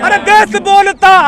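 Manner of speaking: slow, high, loud chanted call; slogan shout. Real, not acted. Activist leads protesters against the government, shouting. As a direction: shout the line for the crowd to answer. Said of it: "पूरी आवाज़ से बोलेंगे".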